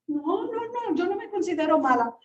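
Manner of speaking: imitating a little girl, emphatic; little girl denying. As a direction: play the girl denying it quickly. Child voiced in retold story.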